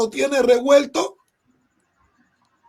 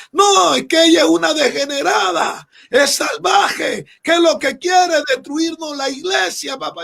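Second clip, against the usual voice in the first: retold angry outburst; 4 semitones higher than usual. Pastor's wife denouncing her daughter, in a mock shout. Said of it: "me dice".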